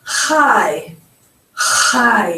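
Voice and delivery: CHAI with a throaty, scraped "kh" onset; strong rasped "kh" opening chai; guttural ch. Shown as a demonstration. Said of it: "Hear that ch sound at the back of your throat".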